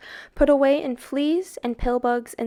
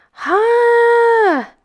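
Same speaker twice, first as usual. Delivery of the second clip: much higher, loud shouted Ha; booming laughing shout; 12 semitones higher, 10 dB louder than usual. A narrator's reading. Sam Hain answers the boy's plea with a huge shouted Ha. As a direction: bark one huge Ha. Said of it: "shouted Sam Hain".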